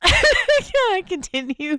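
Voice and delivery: high, slow, laughing. Real, not acted. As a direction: struggle through the laughter. Still laughing, trying to continue.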